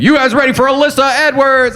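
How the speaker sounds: high, loud announcer hype; hyped announcer. Retold or imitated. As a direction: pump up the crowd like a club MC. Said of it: "the announcer's like".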